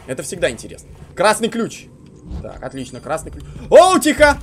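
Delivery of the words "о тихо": sudden loud high yelp; startled. Real, not acted. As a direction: jump at the sight. Monster appears nearby.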